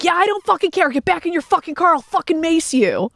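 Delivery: high-pitched furious yell out the window; yelling threat. Retold or imitated. Telling friends how she yelled at the Range Rover driver, high furious yell. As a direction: scream the threat at him, high and fierce.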